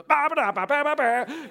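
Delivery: high, loud car-horn babble; excited babble. Retold or imitated. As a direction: blurt nonsense at a passing friend. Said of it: "I'll be".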